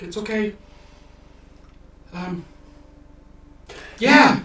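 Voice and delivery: put-on high, loud squeaky old man brushing it off; squeaky and sheepish, pitched high; old halfling embarrassed about crashing his cart, squeaky voice; flustered old halfling. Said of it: "It's like".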